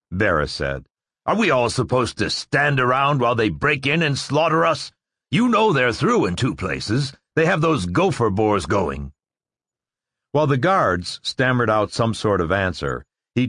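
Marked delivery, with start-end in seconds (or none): 1.2-9.1 angry. Acted. Officer berating hesitant guards.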